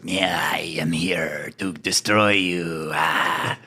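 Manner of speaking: slow, whispered insect voice; flittery bug voice. Acted. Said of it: "flittery bug sounding voice".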